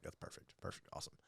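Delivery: whispered, scheming delight; gleeful whisper. Retold or imitated. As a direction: hiss it like a sly plotter. Quoting his own glee.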